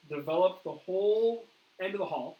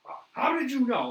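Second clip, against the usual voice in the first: higher and louder, a stunned retold outburst; beside himself; 9 semitones higher, 7 dB louder than usual. Investigator shaken after the ghost encounter, loud stunned disbelief. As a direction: replay the friend's shaken disbelief, pitched up. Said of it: "he was very beside himself".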